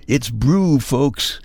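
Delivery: brew punched loud and high; stressed brew. Shown as a demonstration. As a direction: hit the word brew hard. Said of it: "without the burr".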